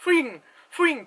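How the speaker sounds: mock swish sound.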